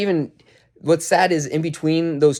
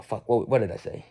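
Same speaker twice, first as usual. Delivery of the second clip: plain reading voice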